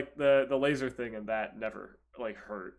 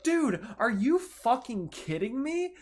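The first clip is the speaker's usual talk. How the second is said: disbelieving outburst at the dentist, higher and laughing; incredulous protest; 8 semitones higher, 4 dB louder than usual. Retold or imitated. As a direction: protest it half-laughing, pitched up. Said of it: "I'm like".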